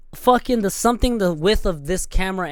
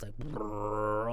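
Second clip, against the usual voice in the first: low, soft rumbling "brrr"; imitated engine; 7 semitones lower, 12 dB quieter than usual. Vlogger showing how a Harley sounds, soft rumble.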